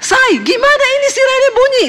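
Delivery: husband's alarmed high cry from the room; panicked shout. Retold or imitated. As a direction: yell for her as the siren goes off. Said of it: "Suami saya teriak".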